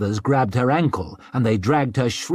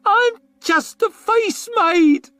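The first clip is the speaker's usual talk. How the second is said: very high, loud, slow squeal; a high, drawn-out frightened squeal; 5 dB louder, slower than usual. A narrator's reading. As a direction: plead in terror. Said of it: "she squealed".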